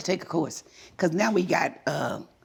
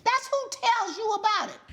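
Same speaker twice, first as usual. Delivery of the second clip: high, incredulous sarcastic scolding; mock his excuse in disbelief; sarcastic scolding tv judge; 11 semitones higher than usual. Real, not acted. Judge ridicules defendant's excuse.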